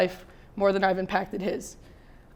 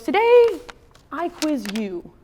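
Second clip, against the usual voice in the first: put-on kid voice; 5 semitones higher, slower than usual. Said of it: "And he goes".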